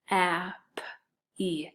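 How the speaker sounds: ap-e sounded out slowly, sound by sound. Shown as a demonstration. Teacher sounding out happy while spelling it. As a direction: stretch out ap-e as you spell it. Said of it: "I would go".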